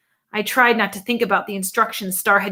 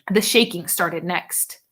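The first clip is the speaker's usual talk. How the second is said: ordinary delivery, nothing marked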